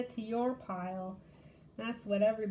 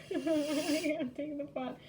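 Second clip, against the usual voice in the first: laughing; 5 semitones higher than usual. Real, not acted. Laughing at own repeated win.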